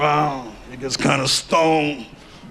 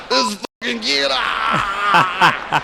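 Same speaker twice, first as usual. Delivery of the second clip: put-on higher mobster accent with a shout; Mafia accent; 4 semitones higher, much slower than usual. Mob boss laughs at the kid with the gun, Mafia accent. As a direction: bark it like a delighted Mafia boss. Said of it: "that's an interesting Mafia accent right there".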